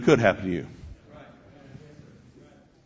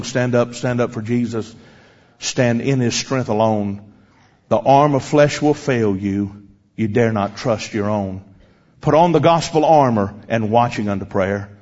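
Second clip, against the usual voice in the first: hymn recitation; 3 semitones lower than usual. Retold or imitated. Preacher closing his story with the hymn, slow solemn recitation. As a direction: recite it measuredly, easing down to a close. Recites the hymn's third stanza.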